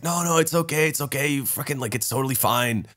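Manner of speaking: hushed, soothing imitation; retold reassurance. Everyone reassuring the panicking storyteller, softly soothing.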